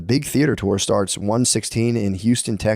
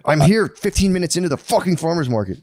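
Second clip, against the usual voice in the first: high-pitched comic outrage; exasperated rant; 6 semitones higher than usual. Retold or imitated. Stuck shopper fed up at the farmers market, comically exasperated.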